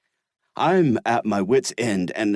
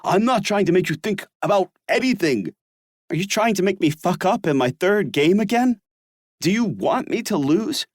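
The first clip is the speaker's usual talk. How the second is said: nearly roaring; 11 semitones higher than usual. Acted. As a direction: push back hard, stung. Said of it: "I nearly roar".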